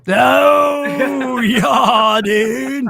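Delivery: loud, high surfer-dude cheer; Bill and Ted dude. Retold or imitated. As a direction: whoop it like a stoner surfer. Excited surfer-dude quote.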